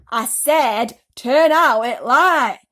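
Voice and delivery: loud, slow furious shout; angry shout. A narrator's reading. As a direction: bellow it, fed up. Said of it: "shouted the centipede angrily".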